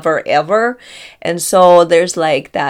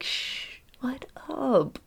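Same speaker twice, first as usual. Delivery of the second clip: playful hushed whisper; 8 semitones higher, 10 dB quieter, much slower than usual. Retold or imitated. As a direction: shush him in a sly whisper. Caught being from Arkansas.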